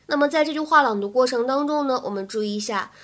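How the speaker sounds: usual speaking voice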